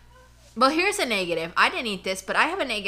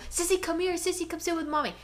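coaxing the dog; 7 semitones higher than usual. Retold or imitated. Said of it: "and I go".

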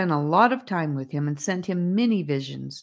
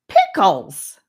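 loud exclamation of exasperation; raised voice; 3 semitones higher, 10 dB louder than usual. A narrator's reading. Charlie stops short and exclaims, voice raised. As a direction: burst out with it, much louder. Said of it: "raising his voice to a soaring height".

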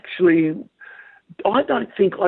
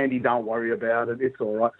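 reassuring; 5 semitones lower, 6 dB quieter than usual. Retold or imitated. Reassuring friend in retold story.

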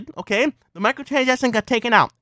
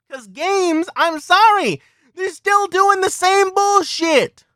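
angry rant; 10 semitones higher than usual. Real, not acted. YouTuber ranting at EA and other companies, angry shouting. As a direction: hammer every question like you're fed up.

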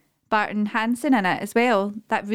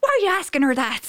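higher-pitched imitation of an Irish accent; mock Irish accent; 6 semitones higher than usual. Re-enacting Maura's comeback from a TV clip, mock Irish accent. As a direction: put on an indignant Irish accent. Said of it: "That's the best accent ever".

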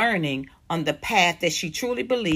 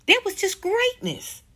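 high emphatic praise; 13 semitones higher than usual. Real, not acted. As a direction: voice jumps way up on greatness.

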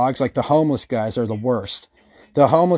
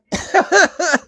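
high mocking cackle; 3 dB louder than usual. Tweaker reaction laugh.

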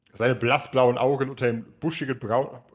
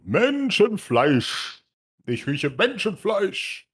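thundering giant voice; 7 semitones higher, much slower than usual. Acted. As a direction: bellow it out like a hungry giant. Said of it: "Die Donnerstimme ist jetzt besser zu verstehen".

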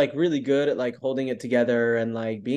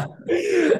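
high laugh; 4 dB louder than usual. Real, not acted. Laughs at the memory.

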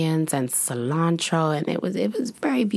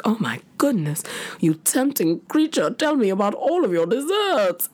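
swooning flirt; 5 semitones higher than usual. Retold or imitated. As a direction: gush at the tempting waiter. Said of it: "I was just like".

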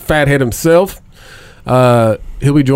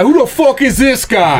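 higher put-on fighter voice; McGregor impression; 10 semitones higher than usual. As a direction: greet the late guest with McGregor's line. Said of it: "That's my favorite line of his".

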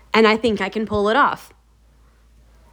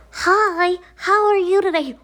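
put-on high-pitched small child greeting; very high, childlike hello; little kid voice; 8 semitones higher, slower than usual. Shown as a demonstration. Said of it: "best little kid voice I've ever heard".

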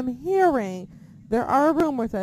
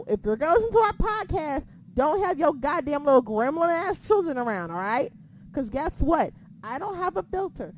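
usual speaking voice

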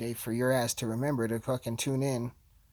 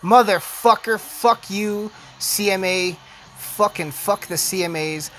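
loud, higher-pitched cursing; angry outburst; 4 semitones higher, 6 dB louder, slower than usual. Real, not acted. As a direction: curse them out at raised volume. Outburst at losing award result.

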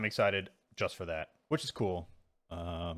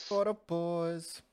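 drawn-out toast; 7 semitones higher, much slower than usual. Pretend.

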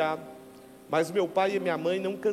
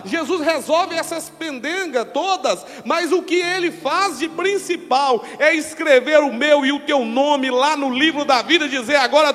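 rising into loud preaching; building sermon; 3 semitones higher, 7 dB louder than usual. Acted. Pastor preaching louder and louder to the congregation. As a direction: build to a fiery climax.

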